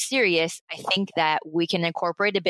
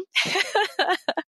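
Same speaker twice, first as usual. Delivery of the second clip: high laugh; 14 semitones higher than usual. Real, not acted. Laughing at begging friends.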